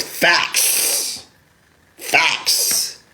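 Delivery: breathy whisper, mocking the commenter; whispered mock "Facts". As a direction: hiss the word Facts like a smug troll.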